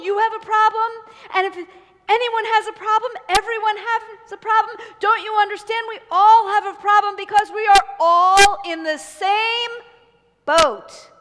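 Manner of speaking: high, loud insistent lecturing; exasperated loud rant. Acted. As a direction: hammer home that everyone shares the problem. Fisherman lectures prince.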